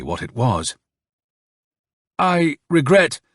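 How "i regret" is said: high, drawn-out regret blurted out; tense, higher-pitched blurt; sudden blurt. A narrator's reading. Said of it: "Turbin suddenly blurted out".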